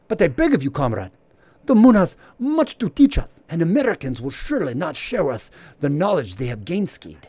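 high, loud pleading mock Russian accent. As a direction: beg dramatically in a hammed-up Russian accent. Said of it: "That's my Russian accent".

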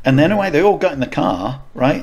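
ordinary talk

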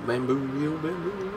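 ordinary delivery

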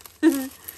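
loud happy burst of laughter; delighted laugh. Real, not acted. Crafter finding a surprise treat bag, loud happy laugh.

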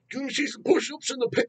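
high, mocking put-on frontman voice. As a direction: send up the singer's stage banter. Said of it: "The guy's literally talking like".

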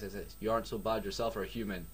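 ordinary delivery, nothing marked

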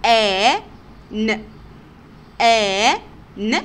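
آ, ن said loud and apart; sounding out letters. Shown as a demonstration. Teacher sounding out letters with pupils. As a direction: sound out each letter clearly. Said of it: "نشوف آخر مثال".

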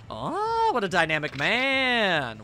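slow, drawn-out admiring coo; swooning hostess. Acted. Hostess swoons over guest, orders champagne.

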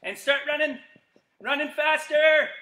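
normal talking voice

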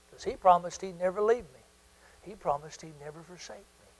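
very soft, low and tender; hushed assurance. Real, not acted. Preacher reassuring the congregation of God's promise, hushed. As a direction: drop almost to nothing, sincerely.

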